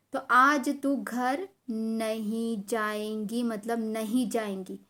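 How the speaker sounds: retold teacher's slow, weighted verdict; mock-stern teacher laying down punishment; stern threat. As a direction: stretch it out word by word, landing on नहीं.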